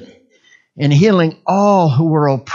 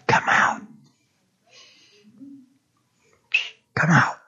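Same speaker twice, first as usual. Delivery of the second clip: soft whispered command; 6 dB quieter than usual. Shown as a demonstration. Preacher showing how to cast the devil out, whispering. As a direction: whisper it as an order to leave. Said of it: "cast the devil out with a whisper".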